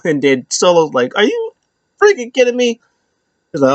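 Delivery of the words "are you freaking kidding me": high-pitched, incredulous imitation; mock disbelief. Retelling a wrestler's reaction to a new member, high mock disbelief. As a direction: push it way up in mock exasperation. Solo's retold exasperated reaction.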